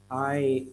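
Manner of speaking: "I'd" as one isolated syllable, like "eyed"; pronunciation model. Shown as a demonstration. Teacher shows how it pronounces, careful model "I'd". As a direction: sound out "I'd" on its own. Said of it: "It pronounces".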